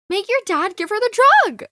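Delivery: high, urgent outburst; urgent demand. Acted. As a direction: demand it, voice jumping up. Pleads for the drug.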